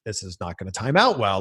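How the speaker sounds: low, quiet aside under the breath; worried mutter to self. Retold or imitated. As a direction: mutter it to yourself, sensing trouble. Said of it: "I'm like".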